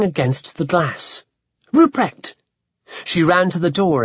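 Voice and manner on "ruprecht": excited call. Acted. Calling to friend at window.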